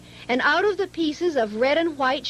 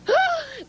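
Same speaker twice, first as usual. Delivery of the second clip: a sharp gasp pitched much higher. A narrator's reading. Maisie swooping into the tent, a high startled gasp. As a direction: gasp in shock like a startled bird. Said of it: "gasped Maisie".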